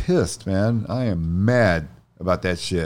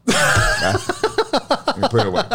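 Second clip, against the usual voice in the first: laughing, caught out, tucks the watch away; 9 semitones higher, slower than usual. Real, not acted. Friend spots his Rolex, he laughs it off. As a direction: giggle through it, a bit embarrassed.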